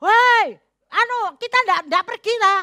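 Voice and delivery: high mock shout, loudest on the first word; mock yelling. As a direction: imitate a husband hollering, pitched high. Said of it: "suami teriak-teriak".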